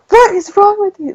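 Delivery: mock-yell at your husband, pitch shooting up; retold exasperated yell. To her husband about the show's writers, a high retold exasperated yell. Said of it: "yelling to my husband".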